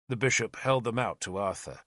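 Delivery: usual speaking voice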